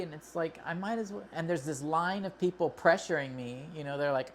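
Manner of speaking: usual speaking voice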